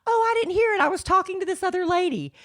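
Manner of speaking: high, quick, breezy friend voice; mock oblivious friend, high and fast; friend's airy reply. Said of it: "She's like".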